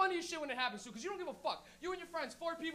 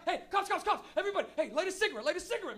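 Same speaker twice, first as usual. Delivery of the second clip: mock frantic alert, louder and higher; panicked warning; 5 semitones higher, 5 dB louder than usual. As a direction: urgently order your friends about in panic. Acted panic in car over cops.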